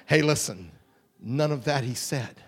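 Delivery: ordinary delivery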